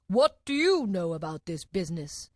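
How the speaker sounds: storybook king questioning a witness; voiced King. A narrator's reading. King questions Alice in court, put-on royal voice.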